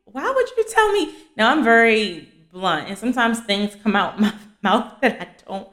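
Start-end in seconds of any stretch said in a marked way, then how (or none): 0.0-1.1 high, incredulous squeak of disbelief; retelling her own shocked face at the doctor's warning; mock shocked. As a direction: play it stunned, pitched way up. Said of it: "And my face was like".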